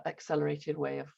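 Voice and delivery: ordinary talk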